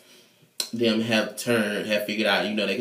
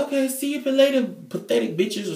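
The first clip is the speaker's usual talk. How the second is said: much higher, sing-song mock sneer; snotty farewell. [name] taunting the inmates as she walks out, high sneer. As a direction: toss it off like a diva leaving.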